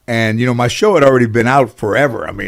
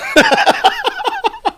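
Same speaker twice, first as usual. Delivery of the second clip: real laughter. Real, not acted. Laughing at the homeless guy story.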